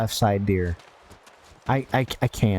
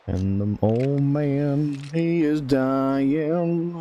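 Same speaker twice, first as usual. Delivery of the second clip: dramatic narration; 2 semitones higher, much slower than usual. Pretend.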